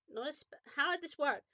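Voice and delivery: ordinary delivery, nothing marked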